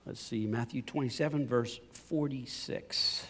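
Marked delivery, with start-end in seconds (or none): none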